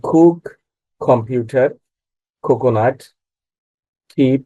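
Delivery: কুক, কম্পিউটার, কোকোনাট, কিপ with aspirated first K. Shown as a demonstration. Teacher gives examples of word-initial sound, K as খ. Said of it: "খ হয়ে যায়".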